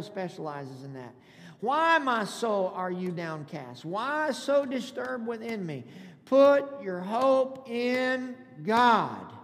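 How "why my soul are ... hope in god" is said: slow reading building to a loud finish; scripture read aloud. Acted. Pastor reading the psalm's refrain to congregation, slow, building preaching. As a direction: draw out the last words like a rallying cry.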